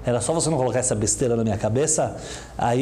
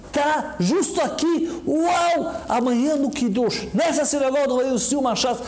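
much higher, near tears, shouting with joy; overjoyed; slower than usual. Acted. Boy thrilled the rabbi came for his siyum, tearful joyful shout. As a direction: burst out with tearful delight.

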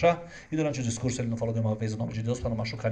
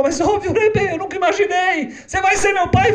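put-on higher, louder child crying out in joy; a girl who can't believe it; overjoyed little girl; 9 dB louder than usual. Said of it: "A menina começa a chorar e fala". (menina emocionada, gritando aguda)

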